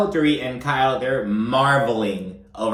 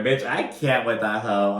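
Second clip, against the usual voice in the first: ordinary talk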